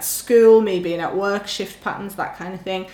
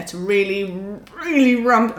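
words pushed through clenched teeth; talking through teeth. Real, not acted. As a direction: speak through gritted teeth. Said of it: "I'm talking through my teeth now".

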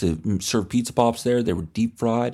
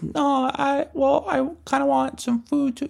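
very high, small timid voice; squeaky pleading voice; meek kid. Retold or imitated. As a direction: object weakly like a scared child.

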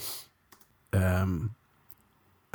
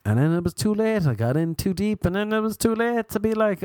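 ordinary talk

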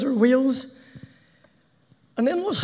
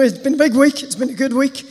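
normal talking voice

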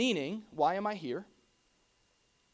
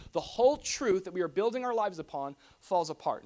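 ordinary talk

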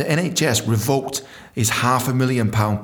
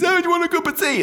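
very high, chirpy mock-friendly call; hammed-up high, eager-to-please voice; eager tea offer; faster than usual. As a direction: sing out the offer, exaggeratedly keen. Acting himself eagerly offering tea.

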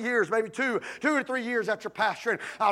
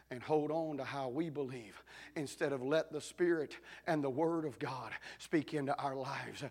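much softer, lower preaching; soft reflective preaching; 7 semitones lower, 9 dB quieter than usual. Acted. Pastor softly explaining forgiveness to the congregation. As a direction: lower your voice and reason gently.

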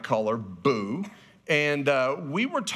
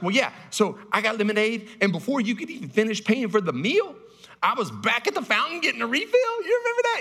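put-on higher and climbing, a kid proudly telling; little daughter voice; 8 semitones higher than usual. Daughter telling dad about her refill, rising to a high squeak. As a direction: build up to a squeaky high question. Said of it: "And she's like".

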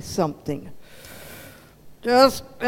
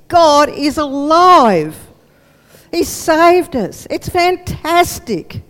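slow, loud, high with joy; joyful proclamation; 5 semitones higher, 6 dB louder, slower than usual. Acted. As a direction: savor each word in wonder. Paul joyfully proclaims God alive.

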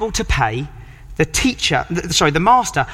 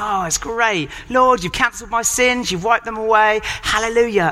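chirpy mock praise, higher; mock happy worship; 5 semitones higher than usual. Congregation gushing over forgiveness, chirpy mock parody. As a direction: parody complacent churchgoers gushing. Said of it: "We love singing".

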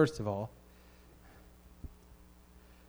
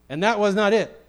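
raised, higher pleading with the Lord; insistent preaching to God; 5 semitones higher, 5 dB louder than usual. Acted. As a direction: take it up firmly with God. Acts out insistent talk to God.

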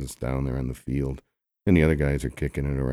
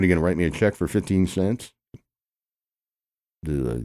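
usual speaking voice

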